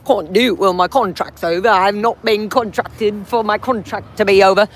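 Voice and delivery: put-on English accent, mocking a guard; hammed-up English-accented impression. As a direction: mimic him in a silly English accent. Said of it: "I don't know why I have an English accent doing that".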